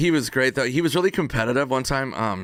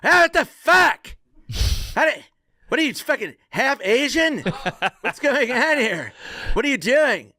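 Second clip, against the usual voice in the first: sputtering rage after losing at ping pong; livid outburst; 11 semitones higher than usual. Retold or imitated. Friend losing at ping pong, mock livid outburst. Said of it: "and he's like".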